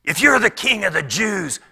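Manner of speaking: loud, high jeering; mocking crowd cries. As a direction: call out taunts as passers-by making fun. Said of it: "the more they cried out".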